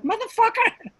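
sudden high, loud snap at a heckler; fiery Richard heckle comeback. Retold or imitated. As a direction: fire it back at the heckler. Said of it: "he became old Richard".

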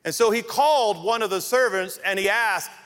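ordinary talk

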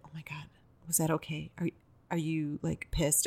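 very soft, confiding mock worry; imitated anxious hush. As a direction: imitate a worried friend asking under the breath. Anxious friend asking privately after show.

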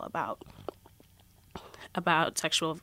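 choked up, struggling to speak. Real, not acted. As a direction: voice catching with emotion. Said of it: "I get, like, really choked up".

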